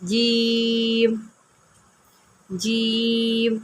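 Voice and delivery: جیم stretched out very slowly; جیم with a long held ee, three alifs long; long drawn vowel. Shown as a demonstration. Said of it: "میں نے تین الف پڑھنا ہے".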